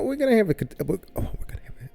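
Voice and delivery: ordinary talk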